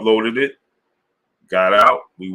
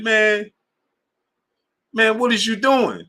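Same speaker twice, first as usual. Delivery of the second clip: mimicking his boy; 12 semitones higher than usual. Friend's question voiced in story.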